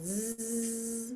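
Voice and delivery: soft buzzing zzz; gentle voiced z buzz; z sound. Shown as a demonstration. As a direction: hum the air out on a steady z. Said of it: "we're gonna do a z sound".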